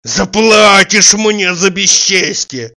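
high, loud, drawn-out threat; distant parting shout. A narrator's reading. Tarantiev calling back while leaving, long angry shout. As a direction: call it slowly across the yard.

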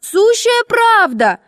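put-on high, drawn-out girlish squeal; squealing schoolgirls. A narrator's reading. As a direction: squeak it out, insisting slowly. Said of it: "запищали девочки хором".

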